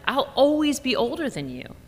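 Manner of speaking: put-on a kid explaining the obvious; high smug kid voice; child explaining. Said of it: "Georgia explained".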